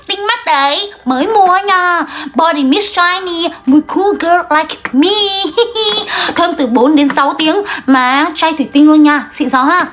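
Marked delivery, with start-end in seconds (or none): 2.3-5.1 slow, drawn-out boasting; showing off. Acted. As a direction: savour each English word smugly. Showing off a new perfume. (khoe khoang kéo dài giọng)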